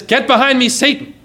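sharp, high-pitched rebuke; challenging rebuke. Acted. Jesus rebuking Peter who stood against him, challenging. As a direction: turn on him forcefully. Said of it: "so challenging".

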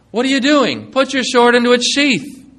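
high, loud and commanding; stern rebuke. Acted. Jesus ordering Peter to sheathe his sword, loud stern command. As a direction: order him to put the sword down.

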